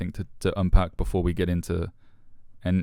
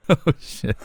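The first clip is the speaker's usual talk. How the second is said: a higher, startled laughing oh shit; caught out; 8 semitones higher than usual. Real, not acted. Realising the question is a trap, caught-out laugh. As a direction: react like you've just been cornered.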